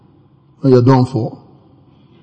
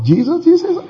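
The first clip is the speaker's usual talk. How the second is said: startled cry; 11 semitones higher, 4 dB quieter than usual. Retold or imitated. Startled by God in bathroom.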